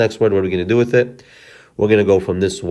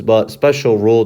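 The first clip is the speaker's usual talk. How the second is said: ordinary delivery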